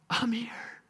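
breathy whispered reveal; whispered I'm here. Acted. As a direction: whisper it right into her ear.

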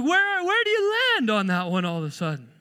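mock exasperation. Preacher baffled by a tangled apology, mock exasperated.

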